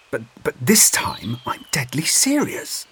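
breathless urgent whisper. Acted. Urgent secret warning.

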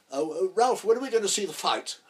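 high, loud, fluting query; Gielgud impression. Gielgud asking Ralph about the fight, high and fluting. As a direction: lift it up like a fussy director. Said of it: "Gielgud said".

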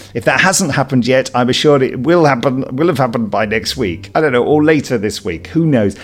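ordinary talk, nothing marked

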